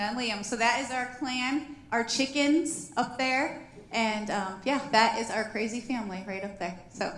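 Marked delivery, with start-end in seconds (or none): none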